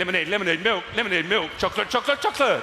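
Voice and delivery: frantic high-pitched choreographer barking cues; shouting it out. Acted. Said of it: "choreographer at the side of the stage shouting it out".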